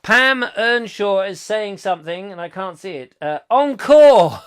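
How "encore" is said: loud, high drawn-out cheer; calling out. Acted. Performer crying for an encore, loud and drawn-out. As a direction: call it out like an audience.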